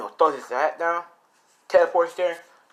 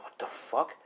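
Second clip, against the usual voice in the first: whispered curse; 10 dB quieter than usual. Acted. Jay shocked by the clone.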